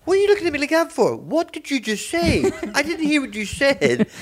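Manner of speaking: high, rushed, baffled voice; a stranger baffled and defensive; incredulous rant. Retold or imitated. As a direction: rant at him in disbelief. Retelling the man's confused outburst.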